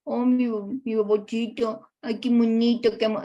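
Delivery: put-on high, cooing diminutive baby talk; doting baby talk. Shown as a demonstration. Teacher mocks a mother cooing to her baby in baby talk. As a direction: coo at the baby in sugary diminutives. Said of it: "no hay que hablarle, por ejemplo".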